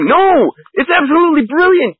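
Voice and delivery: high, excited insistence; emphatic rebuttal. Real, not acted. Defending the show against an imagined critic, emphatic rebuttal. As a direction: burst out in high-pitched praise.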